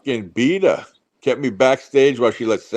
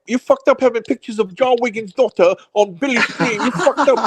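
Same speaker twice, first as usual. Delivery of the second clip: high-pitched put-on caller taunting Billy; hammed-up high-pitched tattling; mock streamer impression; 7 semitones higher than usual. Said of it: "he's probably yelling right now on his stream".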